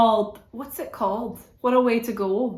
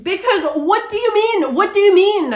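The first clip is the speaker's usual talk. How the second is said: indignant disbelief; 10 semitones higher, 6 dB louder than usual. Real, not acted. Outraged at the ending.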